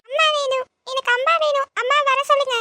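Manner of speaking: put-on high, whiny little girl begging for mum; small girl crying for her mother; crying child voice.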